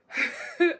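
a sharp, high burst of laughter; quick laugh. Real, not acted. Laughing at herself as her forty-eight-hour villain era ends. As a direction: laugh at your own joke.

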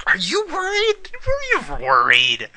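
squeaky mocking question; teasing high voice. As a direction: pitch way up to tease. Teasing the game character.

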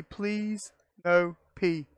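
slow pleading drawl; slow drawn-out plea. Real, not acted. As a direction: stretch each word slowly, begging. Pleading with game not to.

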